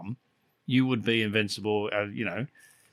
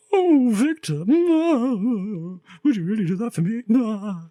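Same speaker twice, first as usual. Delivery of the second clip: high, loud Cockney-free swoon as Lily, mocking; mock swooning woman; 11 semitones higher, 4 dB louder, slower than usual. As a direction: ham up a lovestruck girl's gasp. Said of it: "And she says".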